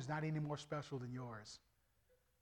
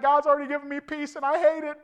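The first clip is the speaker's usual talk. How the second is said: laughing pastor; 11 semitones higher, 14 dB louder than usual. Retold or imitated.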